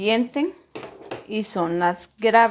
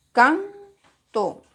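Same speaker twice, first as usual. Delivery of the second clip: "Canto" split in two, higher on CAN; raised CAN, pause, then TO in "Canto"; syllable by syllable; 6 semitones higher than usual. Shown as a demonstration. Teacher shows where the force falls, "Canto" syllable by syllable.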